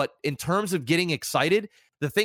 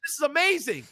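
high-pitched hyped-up outburst; acted excitement; 10 semitones higher, faster than usual. Streamer acting out his joy when viewers like it, excited. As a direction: exclaim it like a thrilled streamer. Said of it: "then I'm excited. I'm like".

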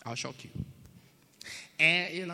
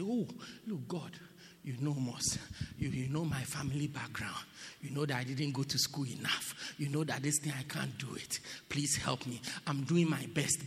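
humble plea; 6 semitones lower, 8 dB quieter than usual. Pretend. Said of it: "come down and say".

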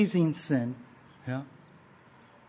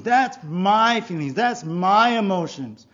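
mock insistence, loud and stressed; self-centered insistence; 4 semitones higher, 7 dB louder than usual. Self-centered person giving in to feelings, insistent. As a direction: claim it possessively, with force. Voices someone defending their feelings.

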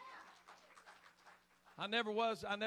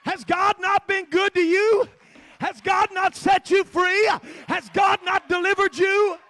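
much higher and louder, shouting at a downcast friend; shouted challenge; 11 semitones higher, 18 dB louder than usual. Retold or imitated. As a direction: demand an answer, full force. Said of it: "We said".

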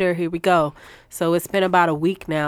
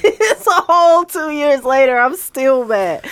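laughing mock outrage; 10 semitones higher, 8 dB louder than usual. Real, not acted. Laughing, still mad.

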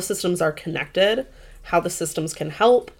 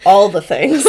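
laughing; 2 semitones higher, 8 dB louder than usual. Real, not acted. Laughing while answering the question.

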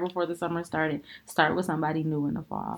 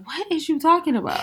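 confused quote; 10 semitones higher than usual. Retold or imitated. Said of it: "And then you left with, like".

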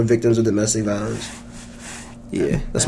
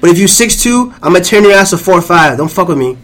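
大声虚张声势的威胁; 半开玩笑地凶巴巴放狠话; mock angry; 5 semitones higher, 5 dB louder than usual. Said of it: "I'm so angry".

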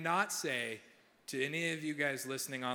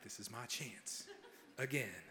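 low, whispered aside to himself; almost whispering, stifling a laugh; hushed inner thought; 5 semitones lower, 13 dB quieter, slower than usual. Retold or imitated. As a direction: secret hopeful inner voice. Inner thought while she's leaving.

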